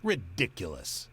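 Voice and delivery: mild bluster from a cop. A narrator's reading. Cop dismissing the driver's demand, mildly blustering. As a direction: bluster the word, mildly indignant. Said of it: "the cop blusters".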